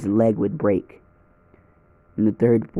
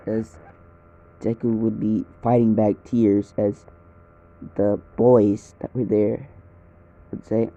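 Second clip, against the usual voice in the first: ordinary delivery, nothing marked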